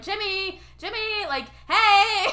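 high, loud shouting across the water; calling out. Retold or imitated. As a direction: hail him from far away. Waving flag to call Jimmy.

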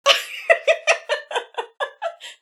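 breathy high-pitched laugh. Real, not acted. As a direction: burst out laughing. Laughing at the spooky wail.

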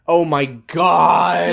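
slow, drawn-out groan of disbelief; long appalled exclamation; exasperated outburst. Real, not acted. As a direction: break off and wail it slowly. Exasperated interruption of own reading.

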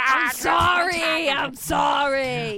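loud, slow, drawn-out sorry; hammed-up wailing sorry; mock apology. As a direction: shout the apology, stretched out. Mock apology after intro flub.

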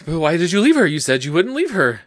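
retelling a friend's angry demand; accusing outburst. Retold or imitated. Retold angry question to Pole.

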